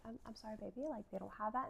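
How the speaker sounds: put-on soft, hushed soothing to a child; calm the upset toddler gently; soothing mom. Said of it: "I'm keeping her calm".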